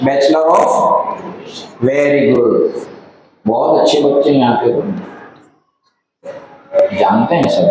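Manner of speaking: ordinary talk, nothing marked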